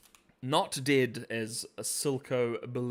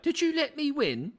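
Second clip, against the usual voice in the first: higher mock Caitlyn voice; lightly imitating young Caitlyn; mimicking Caitlyn; 4 dB louder than usual. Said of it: "Caitlyn being like".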